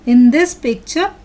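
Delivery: usual speaking voice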